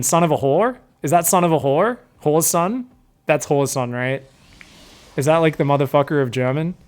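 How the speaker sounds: ordinary talk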